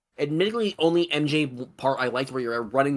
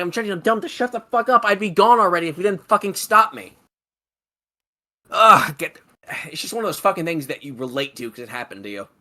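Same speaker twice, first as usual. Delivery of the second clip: usual speaking voice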